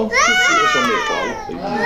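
嗓门一下子拔得很高，快速逗孩子; 用逗小宝宝的尖细腔调说; 娃娃腔. Acted. 对婴儿说娃娃腔. (爸爸逗怀里的小女儿，尖声娃娃腔)

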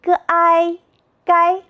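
"g i gai" chanted high and level, g, āi, then gāi; pinyin blending. Shown as a demonstration. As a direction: sound out "g i", then join as "gai", all first tone. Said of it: "在一起组合成了".

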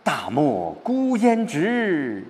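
high, very slow, grandly drawn-out verse; expansive recitation. Shown as a demonstration. Teacher shows the feeling turning around, expansive recitation. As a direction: open it wide, stretching each word high and long. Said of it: "开阔了".

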